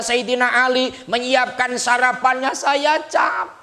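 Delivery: high, loud tired lament of an exhausted daughter; weary complaint. Retold or imitated. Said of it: "Lalu menjawab". (keluhan lelah dengan suara tinggi dan keras)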